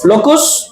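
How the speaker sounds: लोकुस as a questioning "lo-koos?"; students' mispronunciation. Retold or imitated. Teacher echoing children's misreading of locus, mispronounced लोकुस. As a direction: echo the misreading लोकुस with a rising end. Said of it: "कुछ बच्चे locus को लोकुस पढ़ते हैं".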